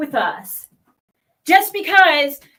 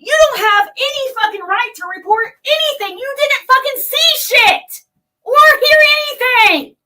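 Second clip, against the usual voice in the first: loud, high, furious yelling at her; rising into a full angry shout; furious rant; 7 semitones higher, 5 dB louder than usual. Real, not acted. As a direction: shout it out in real rage.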